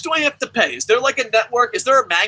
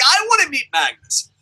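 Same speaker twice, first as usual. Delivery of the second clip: ordinary talk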